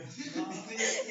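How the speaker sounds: real chuckle; short laugh. Real, not acted. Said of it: "मुझे अभी हंसी क्यों आ रही है". (हल्की हंसी)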